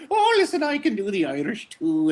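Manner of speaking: high, hammed-up Irish voice; a lightly put-on Irish accent; mock Irish accent. Newcomer boasting he can do Irish too, in mock Irish. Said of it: "hears you doing the Irish thing".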